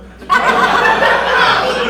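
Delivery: sudden high-pitched cackle at himself; high squealing laugh. Real, not acted. Comic laughing at his own joke, high squeal. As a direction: break into a squeaky giggle.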